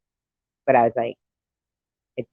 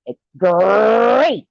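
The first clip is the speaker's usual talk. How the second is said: high, loud drawn-out GREAT; booming cartoon tiger cheer; Tony the Tiger; 9 semitones higher, 6 dB louder than usual. Shown as a demonstration. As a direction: belt it like the cereal tiger. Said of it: "for Tony the Tiger".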